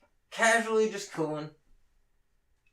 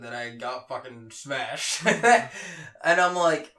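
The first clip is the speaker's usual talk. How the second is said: usual speaking voice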